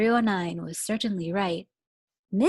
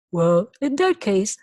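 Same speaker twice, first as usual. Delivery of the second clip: louder fussy principal; 8 dB louder than usual. Acted. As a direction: play the prim principal. Voices the principal.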